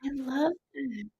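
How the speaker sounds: soft, slow, tearful words, voice catching; fighting back tears, hushed; crying. Real, not acted. Moved medium to her sitter, softly crying. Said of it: "I'm very trying hard not to cry".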